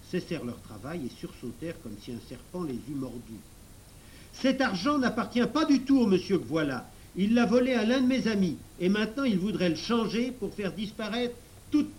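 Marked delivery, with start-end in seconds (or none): 4.3-12.0 high, raised voice denouncing a thief; insistent high-pitched denunciation; loud accusation. A narrator's reading. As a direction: accuse him publicly, urgently. Professor denounces thief before bank staff.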